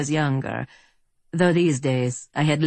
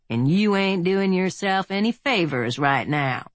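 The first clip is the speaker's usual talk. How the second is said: drawl; 4 semitones higher than usual. A narrator's reading. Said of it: "Earp drawled".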